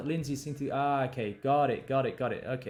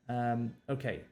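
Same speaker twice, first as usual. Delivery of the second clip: normal talking voice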